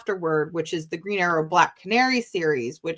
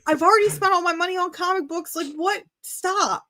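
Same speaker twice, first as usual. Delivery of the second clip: much higher, frantic squeaky panic; panicked disbelief; 11 semitones higher, 5 dB louder than usual. Retold or imitated. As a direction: squeal it out like a broke kid in a panic. Retold panic at artist's table.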